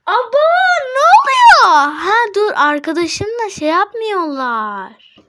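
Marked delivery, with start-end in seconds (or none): 0.1-1.9 high, loud startled cry; shocked shout. Real, not acted. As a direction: blurt it out in shock. Shocked by chat messages.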